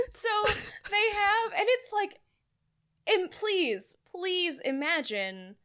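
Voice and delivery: normal talking voice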